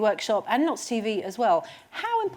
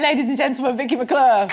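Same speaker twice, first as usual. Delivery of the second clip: announcer; 3 semitones higher, 5 dB louder than usual. Acted. Host announcing the guest.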